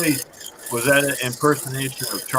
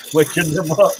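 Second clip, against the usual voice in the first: ordinary delivery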